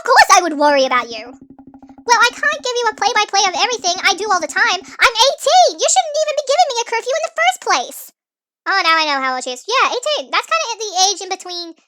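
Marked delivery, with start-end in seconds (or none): 1.3-8.0 put-on higher, louder teen pushback; defiant teen daughter. Daughter pushing back at her dad, higher and louder. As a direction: argue back like a fed-up teenager.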